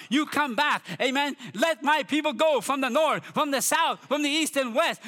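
high, building prophetic proclamation; preacher declaring God's command; fervent preaching. Acted. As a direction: preach it with rising fervour to a peak.

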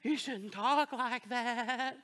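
hushed, very slow shocked scolding; scandalized churchgoer. Retold or imitated. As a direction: gently scold the pastor, pearl-clutching. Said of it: "they'd be like".

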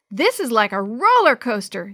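loud, excited yell; thrilled shout; hollering. A narrator's reading. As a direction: holler it over the noise. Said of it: "Sammy hollered".

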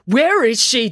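put-on high, loud demanding girl. A narrator's reading. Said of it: "demanded April".

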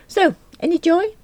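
cheerful tradesman. A narrator's reading. Window cleaner asking about the computer, breezy. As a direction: toss it off cheerfully.

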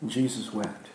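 hushed sermon reading; reverent hush. Real, not acted. Reverent shortest verse.

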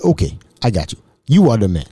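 low-pitched African man, imitated; African accent. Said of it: "Mbutu was an African".

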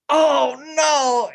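high, pained cry of dismay; dismayed wail. Retold or imitated. Wife cries out after stepping in dog poop, dismayed wail. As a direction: wail it like a small disaster. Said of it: "And she was".

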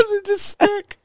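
high laugh through the words; cracking up while speaking. Real, not acted. Laughing at the joke.

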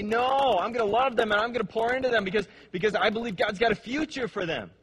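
loud, high, fast insistence on loving them; defiant declaration. Pretend. Believer answering the world about their kids, loud and defiant. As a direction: fight back against despair out loud. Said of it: "And you say".

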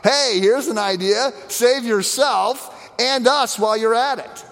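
sneering, sarcastic taunt; mocking thief. Hardened thief on the cross taunting Jesus, mocking. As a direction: jeer at him like a hardened criminal. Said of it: "he mocks Jesus".

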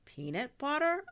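high, loud rising question; incredulous. A narrator's reading. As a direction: echo it back in disbelief. Sister doubts the substitute for cheese.

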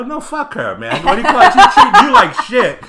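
high, loud, laughing rant; a loud, laughing defence of his coworker; heated outburst. Retold or imitated. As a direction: blow up in gleeful outrage. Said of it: "I go".

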